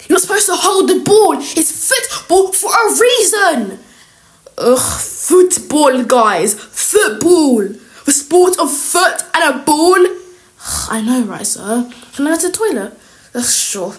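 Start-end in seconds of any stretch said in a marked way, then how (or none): 0.1-10.0 loud, raised, drawn-out telling-off; exasperated coach scolding. Acted. PE teacher to pupils handling the ball, exasperated scolding. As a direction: drum the rule in loudly.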